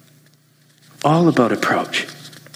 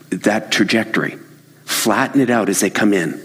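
usual speaking voice

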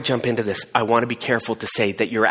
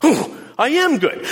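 pleased realization; 12 semitones higher than usual. Acted. Self-realization, slow.

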